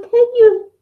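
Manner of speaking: high-pitched mock call-out; hammed-up high "oi, you" challenge; protective challenge. Mother confronting anyone talking to daughter.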